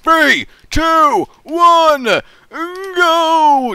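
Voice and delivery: high, loud, punched countdown; announcer countdown. Real, not acted. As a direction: call the start like a game-show host. Counts down the round start.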